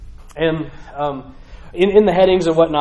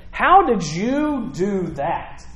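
slow, drawn-out demand with higher pitch; incredulous questioning; 4 semitones higher, much slower than usual. Acted. Council questioning Peter and John about the healing, slowly incredulous. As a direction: stretch each word in disbelief.

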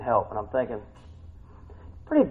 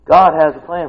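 usual speaking voice

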